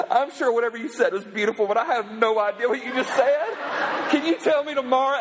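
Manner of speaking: put-on laughing girlfriend. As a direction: laugh while admitting she didn't listen. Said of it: "she goes".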